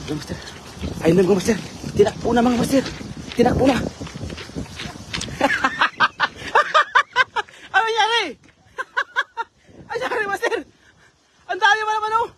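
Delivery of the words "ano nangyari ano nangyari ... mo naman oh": high, giggly laughing questions. Real, not acted. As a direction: laugh while asking what happened. Laughing at companion's mishap.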